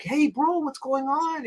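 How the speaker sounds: put-on high-pitched hello to an old friend; imitated delighted hailing; excited greeting. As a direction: greet a long-lost pal, voice way up. Old friend greeting him in retold meeting.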